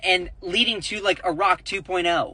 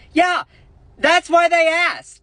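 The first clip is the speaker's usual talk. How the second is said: much higher and louder, slower; indignant outburst; 11 semitones higher, 6 dB louder than usual. Real, not acted. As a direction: insist hard that it was fair to ask. Indignant defence of the reporter.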